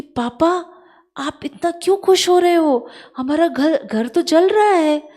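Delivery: urgent cry that the house burns; alarmed son. Retold or imitated. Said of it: "बोलते कि".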